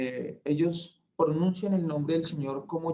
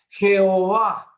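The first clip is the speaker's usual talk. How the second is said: "Jehová" slow, much louder and higher; emphasized name; 5 semitones higher, 10 dB louder, slower than usual. Shown as a demonstration. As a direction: draw out "Jehová" as the climax. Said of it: "por primera vez". ("Jehová" lento, fuerte y agudo)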